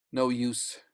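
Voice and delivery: ordinary delivery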